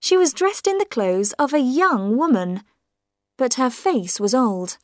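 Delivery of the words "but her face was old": usual speaking voice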